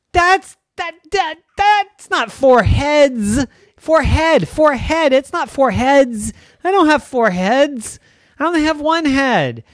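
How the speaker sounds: high, loud flustered denial; frantic kid. Acted. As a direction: stammer out his protest. Brother frantically denying.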